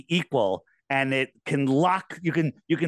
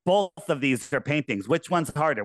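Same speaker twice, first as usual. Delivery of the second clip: ordinary talk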